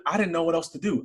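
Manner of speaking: normal talking voice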